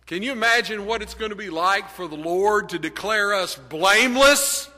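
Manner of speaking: ordinary delivery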